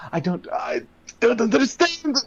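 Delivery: bewildered character voice. Acted. GM voices stunned NPC.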